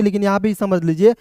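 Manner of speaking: ordinary talk, nothing marked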